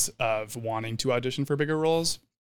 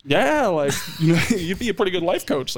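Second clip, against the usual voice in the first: laughing reply; 6 semitones higher than usual. Retold or imitated. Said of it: "I was like".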